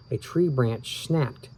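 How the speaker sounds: plain reading voice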